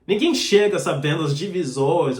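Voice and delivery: ordinary delivery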